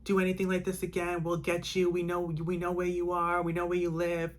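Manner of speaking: sustained high retold shouting; retold screamed threat. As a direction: imitate rescuers screaming a warning, volume held back. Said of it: "the people were screaming".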